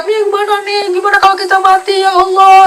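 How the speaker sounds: high, fast hysterical plea; panicked wife. Acted. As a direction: cry out in terror as the ship sinks. Istri panik saat kapal tenggelam. (istri panik histeris, suara tinggi)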